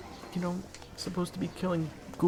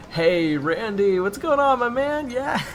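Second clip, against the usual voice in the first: much higher, louder, slow hearty hello; loud fake bro greeting; 9 semitones higher, 7 dB louder, slower than usual. Waiter [name] hailing coworker Randy, loud fake cheer. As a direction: switch to a big cheerful cover.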